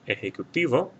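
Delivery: ejecutivo stressed on the TI, e-je-cu-TI-vo. Shown as a demonstration. Teacher pointing out the stressed syllable, ejecutivo stressed on TI. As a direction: lean on the TI in ejecutivo. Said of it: "the stress is on this".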